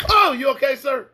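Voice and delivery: high-pitched, solicitous mock alarm; sudden high, over-polite checking on someone; acted concern. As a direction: rush in with exaggerated helpful concern. Acts out sudden concern for stranger.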